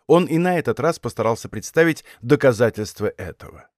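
usual speaking voice

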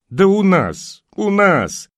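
high, loud cross cry; angry outcry. A narrator's reading. Neighbour in a baseball cap crying out at the question. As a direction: shout it back indignantly. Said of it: "сердито выкрикнула".

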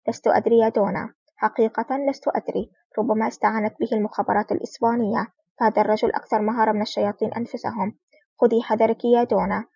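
ordinary delivery, nothing marked

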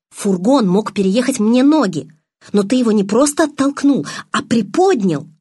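heated accusation. A narrator's reading. Bella confronts Edward with what she saw, heated and insistent. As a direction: pile up the evidence forcefully.

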